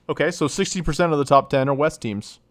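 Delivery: ordinary delivery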